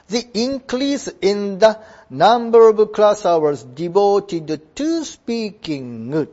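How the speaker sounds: very slow, careful English; slow model reading. Shown as a demonstration. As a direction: read it slowly so the class can follow. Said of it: "どこが大変". (とてもゆっくりした模範読み)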